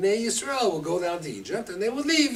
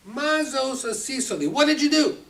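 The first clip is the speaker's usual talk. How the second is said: sharp, louder and higher demand; angry Pharaoh; 8 semitones higher, 5 dB louder than usual. Acted. Pharaoh scolds Avram, loud and high.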